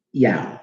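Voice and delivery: Yao said as the Greeks heard it; Greek-style Yao. Shown as a demonstration. Teacher demonstrating how Greeks said the name. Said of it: "what they're saying sounds like this".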